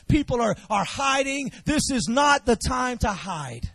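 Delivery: rising, shouted preacher cadence; preaching. Acted. Pastor rallying the church to stand their ground, shouting with rising fervor. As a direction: hammer each line home from the pulpit.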